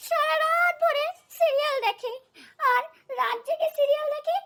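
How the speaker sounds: high-pitched wail of complaint; aggrieved complaint. Acted. Daughter-in-law complaining to mother-in-law. (কাঁদো কাঁদো নালিশের সুর)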